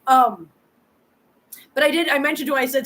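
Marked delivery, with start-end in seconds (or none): none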